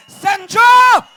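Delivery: very loud, high roaring chant; roaring hometown chant; rally shout. Real, not acted. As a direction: scream the name to the crowd. Crowd chant of the town name.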